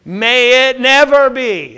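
a slow, emphatic "May it never be"; emphatic answer. Retold or imitated. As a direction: land it as a firm refusal. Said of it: "the answer is very emphatic".

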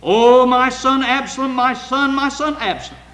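loud, high grieving cry; anguished cry. Acted. David crying out for his dead son, a loud wail. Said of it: "David cried out when his son was dead".

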